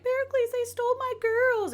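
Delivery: high, whiny damsel voice; mock distressed plea. Aspasia begging Pericles for help, high whiny plea. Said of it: "she went to Pericles and was like".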